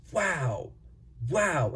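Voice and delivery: high, falling "wow", twice with a pause; retold amazement. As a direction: re-enact being blown away by it. Retold amazement at trying the pen.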